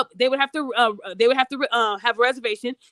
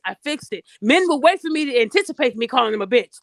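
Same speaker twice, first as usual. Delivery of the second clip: usual speaking voice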